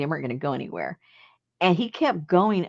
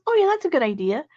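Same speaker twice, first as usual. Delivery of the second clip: high eager agreement; lightly voiced husband, high and keen; mock eager husband; 10 semitones higher, faster than usual. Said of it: "He goes".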